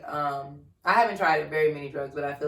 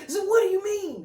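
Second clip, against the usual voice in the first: high, frantic. Retold or imitated. Stoned reply to cops.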